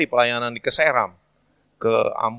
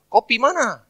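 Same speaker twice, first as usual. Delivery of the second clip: high-pitched Papuan mimicry; clipped Papuan speech; 11 semitones higher, 4 dB louder, slower than usual. Shown as a demonstration. Papuan asking where you are going, high imitated accent. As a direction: run it together like a Papuan, pitched up. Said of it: "Jadi singkat".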